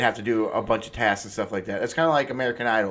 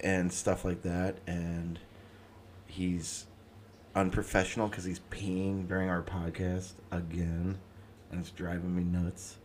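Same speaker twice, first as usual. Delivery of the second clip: conspiratorial gossip; 5 semitones lower, 9 dB quieter than usual. Real, not acted. Gossiping while cohost is away.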